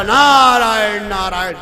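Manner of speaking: long drawn-out 'Narayan', falling away; Narad's holy call. Retold or imitated. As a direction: intone it slowly like a wandering sage. (નારદજીની લાંબી 'નારાયણ' ધૂન)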